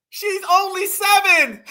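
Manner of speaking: high, loud, drawn-out mock wail; mock horror. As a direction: cry out slowly, hamming up the shock. Mocking horrified woman in picture.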